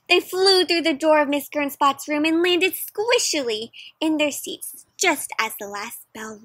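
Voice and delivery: lively storybook read-aloud for a class; reading to children. Acted. Teacher reading a picture book aloud to her class, lively. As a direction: paint the story for the kids.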